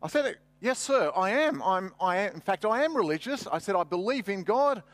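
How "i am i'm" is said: ordinary delivery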